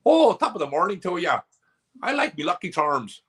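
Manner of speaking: high-pitched Aussie attempt that lands Irish; bad Aussie accent. Shown as a demonstration. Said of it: "give us your best Aussie accent".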